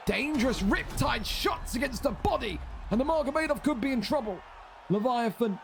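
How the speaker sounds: high excited play-by-play yell; excited shout. Real, not acted. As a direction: shout the knockdown call, rising fast. Calling a sudden knockdown live.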